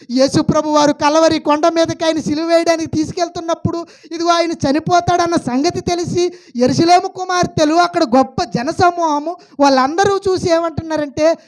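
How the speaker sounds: loud, high preaching voice, pushing each phrase out; full-force preaching. Acted. Preacher booming at the congregation in full preaching force. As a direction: preach it hard to the pews.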